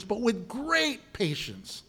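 slow "great patience" with "great" drawn out; stress on great. Shown as a demonstration. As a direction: lean into "great" slowly. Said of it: "Not with patience".

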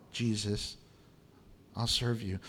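a low, soft, offhand brush-off; dismissive mumble. Retold or imitated. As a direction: shrug Jesus off under your breath. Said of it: "voicing distracted believer".

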